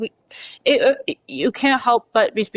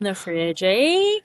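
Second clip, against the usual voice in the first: mock Canadian accent; much slower than usual. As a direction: ham up the Canadian accent, lifting the tag question. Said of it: "Minnesotan accent is more Canadian".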